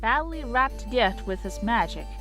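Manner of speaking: ordinary delivery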